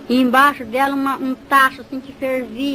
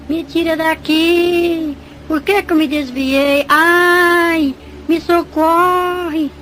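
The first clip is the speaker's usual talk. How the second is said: drawn-out, higher-pitched wailing pleas; imitated tormented cries; 4 semitones higher, 4 dB louder, much slower than usual. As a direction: cry out for rescue, stretching the last vowels. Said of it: "E eu escutava". (gritos arrastados de tormento, imitados)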